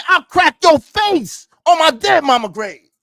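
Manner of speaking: high, loud outraged quote; quoting a threat angrily. Retold or imitated. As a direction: fling the threat back, furious.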